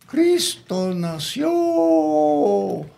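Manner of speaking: high, slow crow stretching the final syllable; rooster crow. Acted. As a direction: crow the words from the church roof. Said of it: "The rooster crowed like this".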